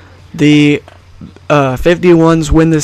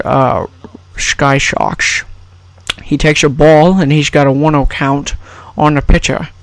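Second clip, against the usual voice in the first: Scottish film star calling play-by-play; slushy sh for every s; Sean Connery. Acted. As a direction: commentate in a put-on Scottish burr. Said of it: "brought to you by Sean Connery".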